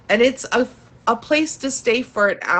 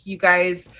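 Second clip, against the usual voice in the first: ordinary talk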